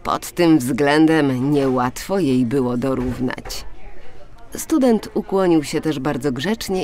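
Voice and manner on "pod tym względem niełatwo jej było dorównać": ordinary delivery, nothing marked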